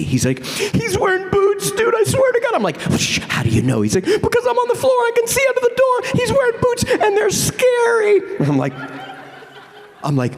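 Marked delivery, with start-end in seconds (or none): 0.4-8.2 very high, shouted mock terror; very high frantic whimper; terrified friend. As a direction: squeal it with fright. Terrified friend under door.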